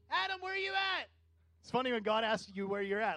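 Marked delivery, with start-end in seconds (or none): 0.1-1.1 high, lightly voiced gentle call; God calling Adam. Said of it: "What did he do?".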